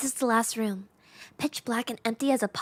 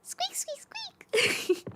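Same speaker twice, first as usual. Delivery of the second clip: high whispered mouse squeaks; 5 dB quieter, much slower than usual. Acted. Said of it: "voice acting the, the mouse".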